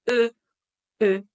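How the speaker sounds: "E" with lips rounded like U while thinking E; French eu sound. Shown as a demonstration. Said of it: "Es la boca de U, pero pensando, intentando decir la letra E".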